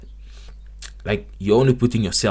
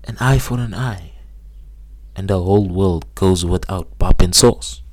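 proud declaration; 7 dB louder than usual. Acted. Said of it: "You know what they say?".

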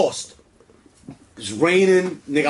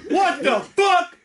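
mock confrontation; 9 semitones higher, slower than usual.

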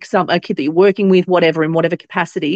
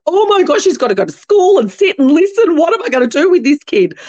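much higher, exasperated mum's outburst; panicked mum; 9 semitones higher than usual. Retold or imitated. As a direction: imitate a mum in a mock panic. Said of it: "suddenly Mum went".

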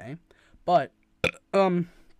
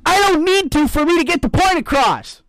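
loud; 11 semitones higher, 13 dB louder than usual. Real, not acted. Heated argument over punctuation.

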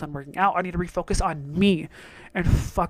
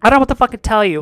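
louder, scornful retort; mock retort; 9 dB louder than usual. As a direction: fire back at a lazy customer. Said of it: "And I'm like".

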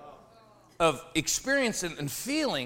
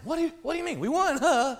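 excited question; 9 semitones higher than usual. Retold or imitated.

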